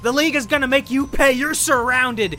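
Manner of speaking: high, strained defiant yell; hammed-up threats from a wounded captive; acted yelling. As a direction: cry out high, desperate and defiant. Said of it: "he's yelling".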